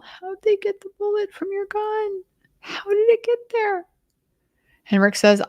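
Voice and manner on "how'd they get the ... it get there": a tiny bewildered question, pitched way up; tiny little voice. Retold or imitated. Narrator voices the wife asking about the bullet, high and tiny. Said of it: "And just her tiny little".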